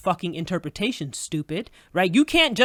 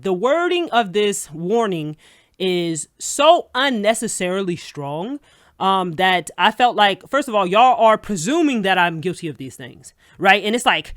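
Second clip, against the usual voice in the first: ordinary talk, nothing marked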